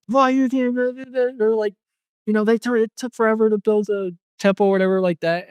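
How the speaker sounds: high-pitched imitation of outraged objectors; mock freak-out. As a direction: push the voice way up, mocking their outrage.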